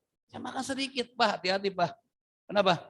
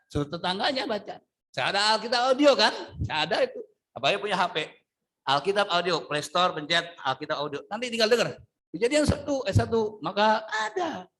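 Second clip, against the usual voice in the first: normal talking voice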